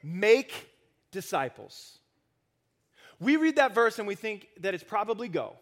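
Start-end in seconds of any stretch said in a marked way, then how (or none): 0.0-2.0 emphasize "make" as the command word; stress on make. Shown as a demonstration. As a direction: hit "make" hard, let "disciples" fall. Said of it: "the verb that has all the emphasis".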